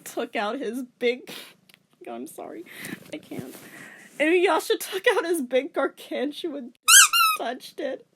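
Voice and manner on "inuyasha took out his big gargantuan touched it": louder, higher, fighting laughter; real flustered reading; embarrassed giggling. Real, not acted. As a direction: push through the giggles. Reader forces through embarrassing line.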